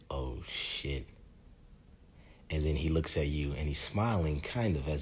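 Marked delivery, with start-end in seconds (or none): 0.1-1.1 hushed, low dread; sinking oh-no moment; stunned realization. Acted. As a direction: let it fall out softly, under your breath. Stunned reaction to being caught.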